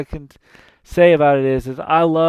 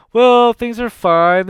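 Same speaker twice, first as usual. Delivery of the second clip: higher, louder mock-cheerful reply; sarcastic Hades; 7 semitones higher, 8 dB louder than usual. Hades answering Zeus, mock cheerful. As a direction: fake brightness, barely hiding the sneer.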